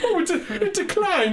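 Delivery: high, laughing mock shock; mock dismay. Joking with the host about a card being declined, high and laughing.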